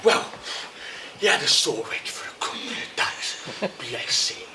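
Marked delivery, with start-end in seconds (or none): 1.2-4.5 low, hushed and fond; soft sympathy. Acted. Owner pitying his dog's sore head, soft sympathy. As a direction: drop low and soft in pity. Feeling sorry for the dog.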